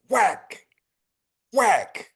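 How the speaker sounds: high, punchy whacks; whack sound effect. A narrator's reading. Narrator acting out the blows, high and punchy. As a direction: smack out each blow.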